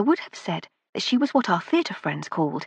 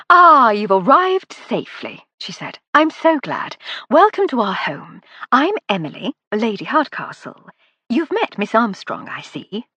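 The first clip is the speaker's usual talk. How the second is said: higher, louder welcoming hostess; lady of the house, bright and gracious, higher; 4 semitones higher, 6 dB louder than usual. A narrator's reading. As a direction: greet guests like a delighted aristocrat.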